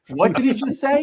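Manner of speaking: high-pitched mock disbelief; incredulous. The whole audience reacting to his story, mock incredulous. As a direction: imitate a stunned crowd's reaction. Voices the shocked crowd's reaction.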